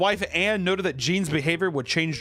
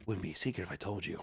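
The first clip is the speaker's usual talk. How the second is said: much lower, much softer and fast; creepy whispered kid; 8 semitones lower, 11 dB quieter, faster than usual. Retold or imitated. Cousin refusing to share secrets, soft fast. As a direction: hush it like a sly child.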